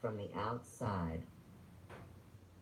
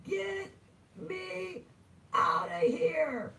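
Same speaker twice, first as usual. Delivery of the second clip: very high, drawn-out mock old-woman yell; 7 dB louder, much slower than usual. Old woman fallen from a tree yells for rescue, mock screech. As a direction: screech it word by word, stretching the last. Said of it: "this old woman falls out of a tree, and she goes".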